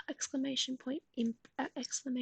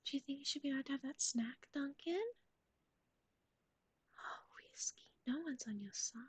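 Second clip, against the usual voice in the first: fading unvoiced whisper, getting softer; 5 dB quieter than usual. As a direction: hush it down to almost nothing. Whispers asking chat about snack.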